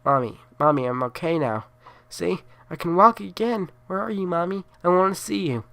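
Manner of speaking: mother imitating her dead son calling her; dead son's voice. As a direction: imitate a small boy calling. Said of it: "My son said".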